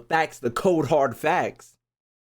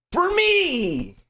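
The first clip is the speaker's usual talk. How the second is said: squeaky high repeat. Real, not acted. Streamer ranking Genshin nations, squeaky high "for me". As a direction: jump way up into a squeak on "for me".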